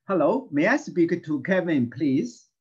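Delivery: loud clear drill voice for the class; teacher leading a repeat-after-me drill. Shown as a demonstration. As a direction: say it clearly so they can repeat. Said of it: "Read after me, please, three times".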